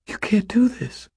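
high, stricken whisper; pleading, distressed whisper. A narrator's reading. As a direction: whisper it in disbelief. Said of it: "I whispered".

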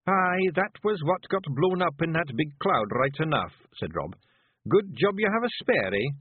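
high, rough little Scottish pictie, gently; a tiny Scots warrior breaking bad news; Feegle voice. Rob's Feegle voice.

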